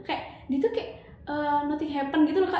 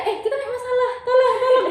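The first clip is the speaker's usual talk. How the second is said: much higher, louder pleading; urgent plea; 12 semitones higher, 7 dB louder than usual. Retold or imitated. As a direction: insist he remember the problem. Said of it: "Dia malah nggak nyelesain".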